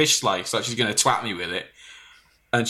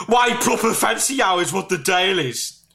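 Ryan voice; 7 semitones higher than usual. Retold or imitated.

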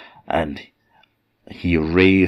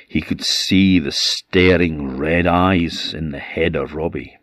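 usual speaking voice

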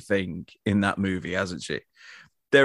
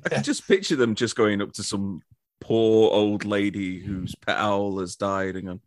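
ordinary talk

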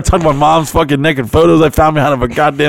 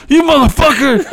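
mock angry yell; 10 semitones higher than usual. Coming up angry after near drowning.

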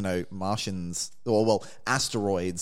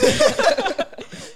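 high squealing laugh; 6 dB louder than usual. Real, not acted. Laughing before summary.

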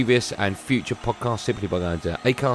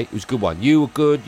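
normal talking voice

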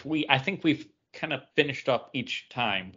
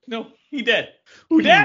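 gleeful joke repeat; 10 semitones higher, 9 dB louder than usual. Real, not acted. Repeating own joke, laughing.

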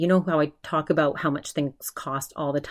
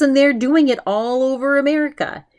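imitating an outraged rant; mock indignant, pitch up; 9 semitones higher, 6 dB louder than usual. Ben ranting about the bony steak, mock outrage. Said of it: "he's outraged at the price".

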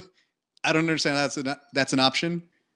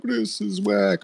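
higher, slow, scornful fan voice; mock fan complaint; 7 semitones higher, slower than usual. Fans reacting to Rebels, a slow mocking sneer. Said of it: "people are like".

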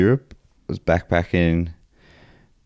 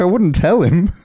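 high-pitched joking defence; mock protest; 12 semitones higher, 4 dB louder than usual. Host defending the Harambe idea to co-host, high mock protest. Acted comic reply to the objection.

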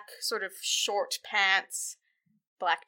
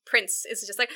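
normal talking voice

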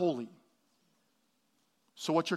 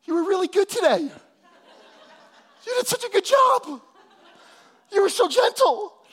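much higher, louder, laughing mock voice of the wife; wife's praise; 13 semitones higher, 6 dB louder than usual. Pastor imitates his wife praising his gentleness, high mock voice. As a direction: play the wife gushing with praise, high and bright. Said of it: "She was like".

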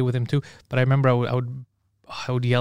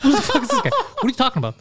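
high, pitched-up baffled outburst; high retold disbelief at his managers; 10 semitones higher than usual. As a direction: act out being baffled. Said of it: "I was like".

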